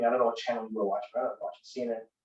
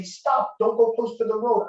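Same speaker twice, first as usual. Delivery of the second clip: sharp high parental "Stop!"; calling out a warning; 9 semitones higher, 4 dB louder, slower than usual. Retold or imitated. Parent shouting at his kid near the road, high alarm. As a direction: yell to halt a child running to the car.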